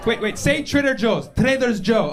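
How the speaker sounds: high voice saying "Trader's Joe" with an extra s; grandma's mispronunciation. Retold or imitated. Grandson coaxing grandma's "Trader's Joe", high and amused. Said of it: "That's how she says it".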